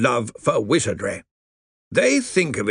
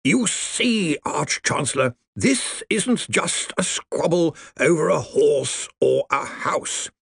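put-on dry crackling old lawyer voice; grave undead lawyer, slow; crackly zombie lawyer; 8 semitones higher, slower than usual. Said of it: "his voice crackled".

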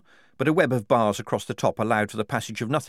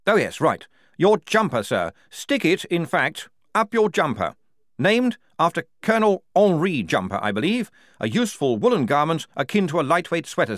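higher, louder pompous officer voice; a stiff lieutenant giving a formal refusal; lieutenant Blouse; 6 semitones higher, 4 dB louder than usual. Acted. Lieutenant lectures the enemy officer.

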